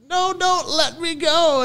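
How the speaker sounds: high, drawn-out pleading cartoon voice; Facebook app. Phone app begging not to be deleted, pleading. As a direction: wail it slowly in a put-on squeak. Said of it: "the Facebook app was like".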